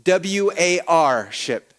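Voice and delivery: W A R ship spelled slowly letter by letter; slow deliberate spelling of W A R ship. Shown as a demonstration. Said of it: "worship becomes warship".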